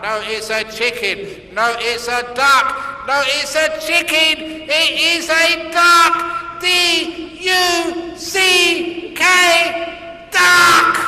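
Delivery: rising from firm to a high shouted duck; escalating quarrel. Acted. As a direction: build the fight to a spelled-out shout. Couple's escalating argument.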